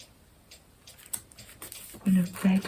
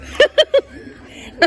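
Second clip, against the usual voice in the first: high laugh; 7 dB louder than usual. Real, not acted. Laughing at Friends joke.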